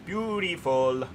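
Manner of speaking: a long dreamy Beautiful; drawn-out admiring. Acted. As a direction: let Beautiful melt out slowly. Admiring the new train pack.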